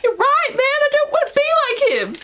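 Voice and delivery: squeaky, hammed-up giving in; high-pitched mock voice. Feyre conceding to her friends, high mock squeal. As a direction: put on a high, exaggerated voice of agreement.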